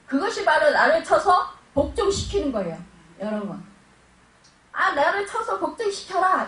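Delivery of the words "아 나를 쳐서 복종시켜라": quoting the command. Retold or imitated. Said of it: "이렇게 하는데".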